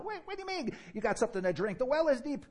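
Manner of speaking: baffled woman. Retold or imitated. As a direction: play the confused woman at the well.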